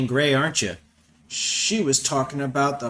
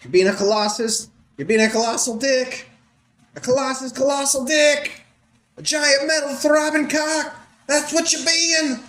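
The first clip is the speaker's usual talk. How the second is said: mock rant; 6 dB louder than usual. Real, not acted. Riffing insults at Professor X.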